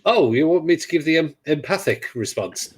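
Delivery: sneering at the empathic dialogue option; mocking. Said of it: "all the sarcastic responses".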